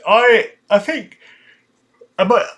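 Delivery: words stretched through a yawn. Real, not acted. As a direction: talk while yawning. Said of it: "there's the yawn".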